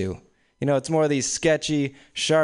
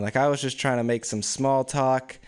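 ordinary talk, nothing marked

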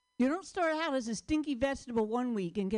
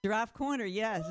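normal talking voice

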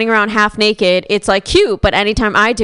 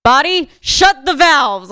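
slow, louder robotic order; deadpan shutdown command, dragged out; mock brain command; 4 semitones higher, 4 dB louder, slower than usual. Grandma's brain ordering her body, slow robotic command. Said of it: "her brain was like".

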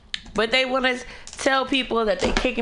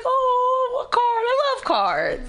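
very high, drawn-out coo; high-pitched gushing thanks; acted delight; slower than usual. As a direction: squeal with touched delight over a little gift. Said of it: "I'm like".

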